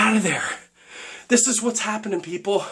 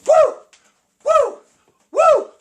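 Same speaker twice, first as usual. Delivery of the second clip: mock woo yell; 6 dB louder, slower than usual. Imitating kicking lady's yells.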